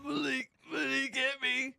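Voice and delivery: put-on high whiny tattling child voice; crying kid. Young son on the phone tattling to dad, high and whiny. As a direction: wail it up high like a hurt little kid.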